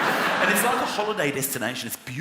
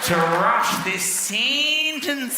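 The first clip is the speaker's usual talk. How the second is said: very slow Australian drawl stretching the vowels; slow drawling Australian accent; 3 semitones higher, much slower than usual. Retold or imitated. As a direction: lean into each Aussie vowel. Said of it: "they're just like".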